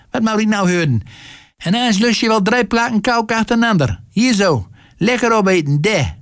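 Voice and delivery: high-pitched motherly chiding; high, fussing mother; coaxing mother. A narrator's reading. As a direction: urge the boy to eat, voice pitched high. Mother coaxes son to eat cake.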